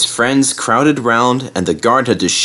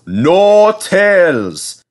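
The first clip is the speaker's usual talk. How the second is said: put-on very slow, louder, firm old engine; 10 semitones higher, 4 dB louder, much slower than usual. A narrator's reading. Skarloey stopping the coaches telling tales, firm. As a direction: cut them off firmly. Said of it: "said Skarloey firmly".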